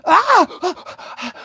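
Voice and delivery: high, sharp imitated infant scream "Ah"; imitated baby scream. A father imitates his baby screaming during surgery. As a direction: cry out like a newborn in pain. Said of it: "the baby goes".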